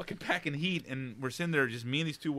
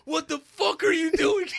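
slow, much higher and louder retold yell; mock shout; 8 dB louder, slower than usual. Friend Adam yells at him in the dark, mock shout. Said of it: "He goes, my friend comes on".

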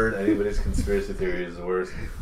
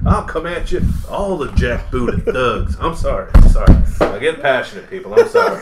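hammed-up apologetic rant, higher and louder; over-the-top passionate conspiracy host; Alex Jones impression; 8 semitones higher, 8 dB louder than usual. Friend keeps voicing Alex Jones apologizing to families, loud mock rant.

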